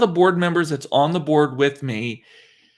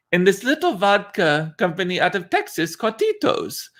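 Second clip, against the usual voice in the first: higher-pitched imitation French accent; 5 semitones higher than usual. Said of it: "That's my French accent".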